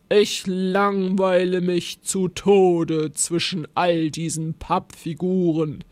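slow, growly creature complaint; grumbling monster. A narrator's reading. Bored monster complaining to captured Rosa, growling.

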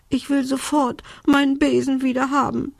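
high, whiny tearful demand; sobbing. A narrator's reading. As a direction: wail it like an upset little witch. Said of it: "schluchzte Belinda".

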